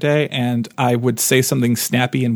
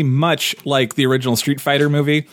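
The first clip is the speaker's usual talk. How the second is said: usual speaking voice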